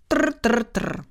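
clipped mechanical tr tr; typewriter clatter. Acted. Narrator mimics the typewriter keys, mechanical rattle.

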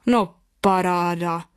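slow, drawn-out astonished exclamation; surprised father. A narrator's reading. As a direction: stretch it out in stunned surprise. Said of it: "vykřikl překvapeně tatínek". (překvapený, protáhlý tatínkův povzdech nad spouští)

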